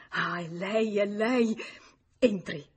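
esclamazione concitata, più forte sul finale; agitato. A narrator's reading. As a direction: accoglila con sollievo impaziente. Agitated repeated greeting at the door. (agitato, concitato)

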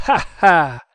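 loud scornful ha-ha; sharp contemptuous laugh; mocking laugh. A narrator's reading. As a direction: bark out a sneering laugh at him. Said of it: "fece Manciadi".

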